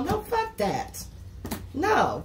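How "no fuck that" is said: high, slow, drawn-out refusal; voicing the client's flat refusal; slow dismissive no. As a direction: stretch out the brush-off. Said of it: "the next minute you go".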